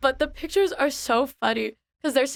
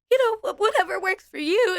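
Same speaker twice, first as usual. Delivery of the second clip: flustered squeak; 8 semitones higher than usual. Retold or imitated. Flustered reply to cosplayer.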